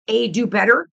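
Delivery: normal talking voice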